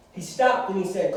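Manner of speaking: ordinary talk, nothing marked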